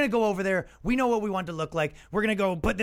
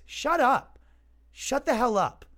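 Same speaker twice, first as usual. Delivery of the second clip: snappy, sassy brush-off, a bit higher; 5 semitones higher, slower than usual. Real, not acted. Fed up with the privilege talk, a sassy shut up. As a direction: tell them to shut up with attitude. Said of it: "I'm getting sassy".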